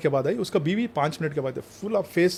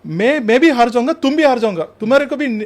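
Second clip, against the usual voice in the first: loud, higher, mocking the one who drags others down; spiteful leg puller; 7 semitones higher, 8 dB louder than usual. Host acts out a leg puller to the interviewer, loud and high. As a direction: shout it like a jealous man who would rather both lose. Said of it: "Leg pullers".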